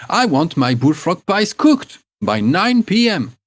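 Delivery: put-on old hag sending the girls off, demanding her pies. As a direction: brush them off like a bossy old hag. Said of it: "she adds another term".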